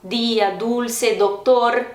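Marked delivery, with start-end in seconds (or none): 0.0-0.5 dia with a soft dental d; Spanish soft d. Shown as a demonstration. Teacher drilling learners on Spanish dia, soft d. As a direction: say dia as "dee-ah", never "djia". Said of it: "sempre suave".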